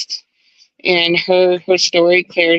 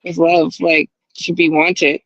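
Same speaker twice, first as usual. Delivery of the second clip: ordinary delivery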